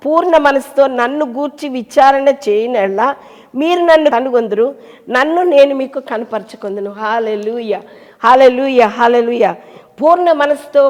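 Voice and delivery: loud, fervent preaching of scripture; preaching at full force. Acted. Pastor proclaiming scripture to the congregation, loud and fervent. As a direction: proclaim the verse with full conviction.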